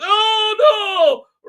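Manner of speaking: very high, loud mock shriek of "Oh no!"; mock cry. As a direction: squeal it as a hammed-up panic. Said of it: "and it goes".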